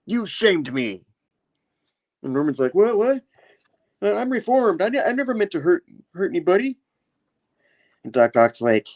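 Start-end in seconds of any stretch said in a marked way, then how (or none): 0.0-1.0 mild mock tirade, pitched up; laying into him. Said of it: "really laying in to Norman". 2.7-6.8 high, stammering mock panic; scared Norman. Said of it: "you know, scared".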